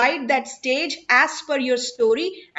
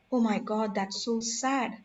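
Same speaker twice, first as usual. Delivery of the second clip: sad; 2 semitones lower, 7 dB quieter than usual. Shown as a demonstration. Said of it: "your voice is going down when you are sad".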